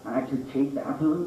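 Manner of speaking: ordinary talk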